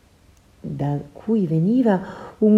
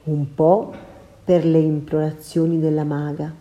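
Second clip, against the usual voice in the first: usual speaking voice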